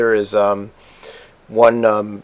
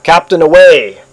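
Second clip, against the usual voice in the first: raised, louder shipboard shout; first mate yelling back; 6 semitones higher, 7 dB louder than usual. A narrator's reading. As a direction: call it up to the captain. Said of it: "she yells back".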